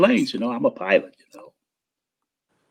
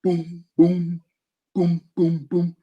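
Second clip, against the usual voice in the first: rounder booming drum hits; vocal boom of an open drum; melodic kick drum boom; 3 semitones higher, 4 dB quieter, slower than usual. Shown as a demonstration. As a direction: sing out a ringing boom. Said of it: "like a".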